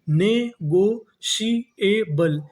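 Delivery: Negotiable stretched very slowly, each syllable apart. Shown as a demonstration. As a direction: spell it out slowly for the class. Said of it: "तो meaning क्या बनी". (धीरे-धीरे शब्दांश तोड़कर)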